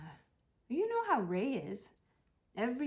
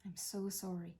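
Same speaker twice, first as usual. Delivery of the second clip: soft apology; 8 dB quieter than usual. Real, not acted. Quiet apology again.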